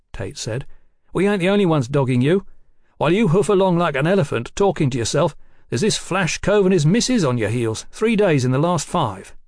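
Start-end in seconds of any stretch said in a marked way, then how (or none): 1.1-9.4 higher, louder streetwise informant; cheeky rogue detective. Acted. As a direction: spill the news with cocky relish, pitched up. Voices Tate telling what he knows.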